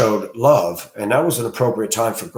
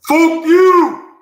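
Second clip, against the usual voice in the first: much higher, much louder retold shout; teenage yell; 9 dB louder than usual. As a teen yelling through the library doors, loud shout. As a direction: bellow it down the library hallway. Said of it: "Why'd you yell 'fuck you'".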